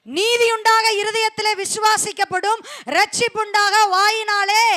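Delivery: loud, high verse proclaimed; preaching at full force. Acted. Quoting verse, exhorting. (உரத்த வேத பிரகடனம்)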